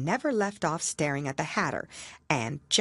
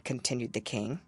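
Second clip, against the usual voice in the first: usual speaking voice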